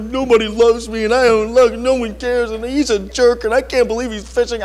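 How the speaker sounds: a hint of a pouting boy; lightly voiced self-pity of a sulking kid; sulky child. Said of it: "because I was like".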